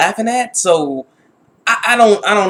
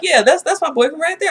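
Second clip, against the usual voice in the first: boastful lie; 11 semitones higher than usual. Retold or imitated.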